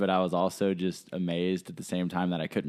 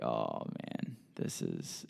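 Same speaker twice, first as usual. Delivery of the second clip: low, soft sinking groan of words; retold weary dismay, dropped way down; resigned dread; 11 semitones lower, 12 dB quieter, much slower than usual. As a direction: let it sink quietly, as if caught. Dreading the stranger's approach.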